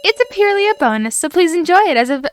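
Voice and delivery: high, loud and chirpy game host; high-pitched cutesy host with a little giggle; game host character. Acted. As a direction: welcome players in a lively high voice. Reads game host's introduction aloud.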